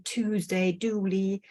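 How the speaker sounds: Tuesday and duly without the y glide; yod-dropping. Shown as a demonstration. Lecturer giving examples of Cockney sounds, yod-dropping. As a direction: say Tuesday as "Toosday", duly as "dooly". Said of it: "we also have yaw dropping".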